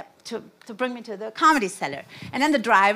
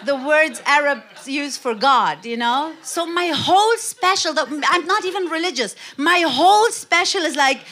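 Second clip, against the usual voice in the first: ordinary talk, nothing marked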